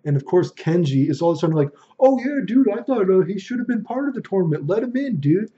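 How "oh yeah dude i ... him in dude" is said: much higher, gushing imitation; mock eager support. Friend urges team to let player in, mock eager support. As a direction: suddenly take his side, high and insistent. Two-faced friend suddenly backs the pouter.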